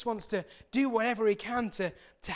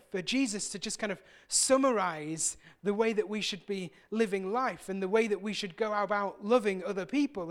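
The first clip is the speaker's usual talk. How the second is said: ordinary talk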